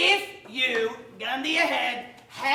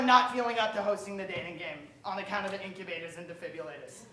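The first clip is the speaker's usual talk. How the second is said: rushed aside; 8 semitones lower, 7 dB quieter, faster than usual. Acted. Rushing through awkward disclaimer.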